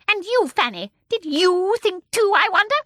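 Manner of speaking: put-on high, loud, accusing old woman; high little voice. A narrator's reading. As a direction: turn sharply on the other daughter. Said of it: "The high little voice".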